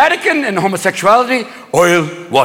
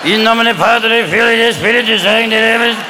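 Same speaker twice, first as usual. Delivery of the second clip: slow, droning papal blessing; monotone chanted benediction; John Paul the Second. Retold or imitated. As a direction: intone the Latin like an old pope. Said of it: "Remember John Paul the Second? It was like".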